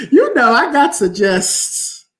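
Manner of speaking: laughing through the words; amused, chuckling along. Real, not acted. Host laughing after the sermon joke, chuckling. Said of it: "The Terrell sermon".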